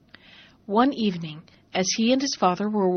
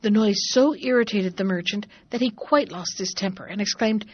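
ordinary delivery, nothing marked

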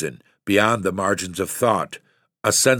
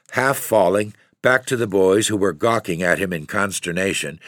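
plain reading voice

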